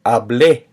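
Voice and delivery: hablé, landing firmly on the final "blé"; carefully modeled hablé, ending stressed; stress on final syllable. Shown as a demonstration. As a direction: punch the last syllable of hablé. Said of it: "it would sound like this".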